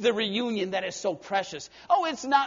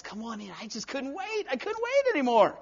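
eager welcome; 5 semitones higher than usual. Acted. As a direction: burst with delight at the homecoming. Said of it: "God says".

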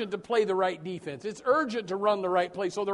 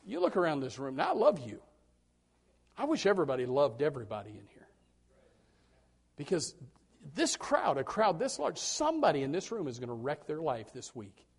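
ordinary talk

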